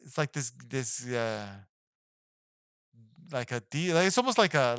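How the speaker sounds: ordinary delivery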